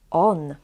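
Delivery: "on" with O closed by a clear n; on sound. Shown as a demonstration. Teacher recaps ways to say the letter, on sound. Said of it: "three ways to".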